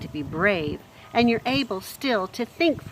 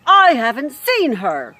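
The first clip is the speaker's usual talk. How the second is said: loud, higher, slow shout; 6 semitones higher, 11 dB louder than usual. Acted. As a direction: yell it at the whole kitchen. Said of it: "Louise was shouting".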